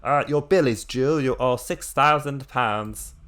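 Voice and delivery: loud, high put-on caller voice; hammed-up bill collector on the phone; terrible phone caller. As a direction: announce it loudly, then stretch out the sum. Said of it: "I got that terrible call".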